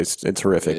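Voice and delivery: deadpan horrific. Real, not acted. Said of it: "funny the way you brought that in".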